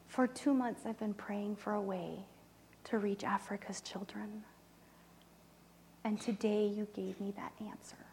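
soft, near-tearful retelling; holding back tears. Real, not acted. Moved to tears retelling his friend's words, hushed. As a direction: voice thinning with real emotion. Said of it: "'cause now I'm gonna cry".

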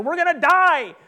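high, loud panicked outcry; mock-terrified disciples; acted panic. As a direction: wail it in hammed-up disbelief and panic. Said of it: "there's this panic".

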